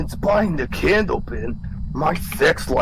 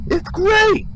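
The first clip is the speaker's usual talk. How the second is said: squeaky high voice. Acted. Squeaky testimonial line.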